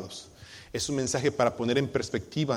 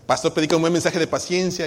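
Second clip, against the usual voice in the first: mock request; 3 semitones higher, 7 dB louder than usual. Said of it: "Y usted pasa bien".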